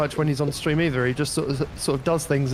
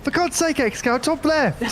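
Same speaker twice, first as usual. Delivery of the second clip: high-pitched mock callout, much higher; mock shout; 10 semitones higher than usual. Teammate mock-shouting a map callout in high voice. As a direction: yell it like an urgent teammate callout. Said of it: "I'll just shout at you".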